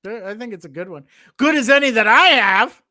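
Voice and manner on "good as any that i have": loud, high, hammed-up British accent. Shown as a demonstration. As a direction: belt out a showy mock-British exclamation. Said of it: "There's my British accent, everyone".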